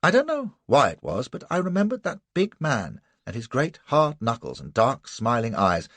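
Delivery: plain reading voice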